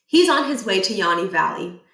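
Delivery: plain reading voice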